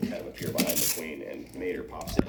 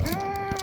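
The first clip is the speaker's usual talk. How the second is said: high-pitched cartoon scream; freaked-out scream. Truck realizes he met himself, high mock scream. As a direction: scream high without much volume. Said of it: "freaks out".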